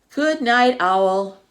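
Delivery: owl's good night. Acted. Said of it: "he said".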